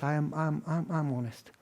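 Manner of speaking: hesitate, embarrassed; the woman admitting to the pastor, halting and soft; halting quiet admission. Retold or imitated.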